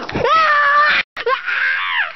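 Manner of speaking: high ghostly laugh, shouted. Acted. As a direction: cackle like a ghost. Ghost appears.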